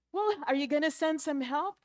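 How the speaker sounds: high, loud impatient plea; acted exasperated shout; urgent demand. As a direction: call up urgently for help. Jack impatient on the branch.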